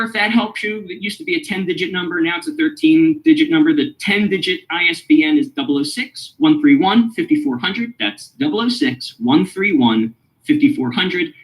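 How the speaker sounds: normal talking voice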